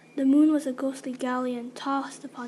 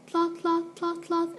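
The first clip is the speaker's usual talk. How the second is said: higher, level-pitched clip-clop chant; imitated hoofbeats; 4 semitones higher, slower than usual. Hoofbeats reaching the waiting girl, imitated on a higher note. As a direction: beat out the hooves on a raised note. Imitating distant horse hooves.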